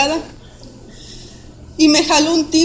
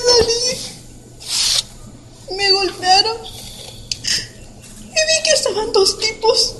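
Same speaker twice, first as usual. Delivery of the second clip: high, breaking voice fighting sobs; tears break through as she relives the blow; real crying; 8 semitones higher than usual. Real, not acted. Survivor telling how a machete nearly killed her, sobbing.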